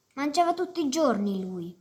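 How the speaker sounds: ordinary delivery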